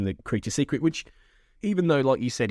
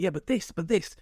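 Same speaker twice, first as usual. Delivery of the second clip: higher-pitched imitated objection; eager back-and-forth arguing; 7 semitones higher than usual. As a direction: imitate someone interjecting in a debate. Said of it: "Scully's questioning him".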